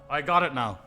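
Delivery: ordinary talk, nothing marked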